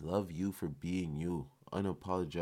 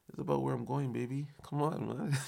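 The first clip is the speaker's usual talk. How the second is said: excited; 8 semitones higher than usual. Real, not acted. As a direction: pump it up, voice soaring. Hyped about his journey.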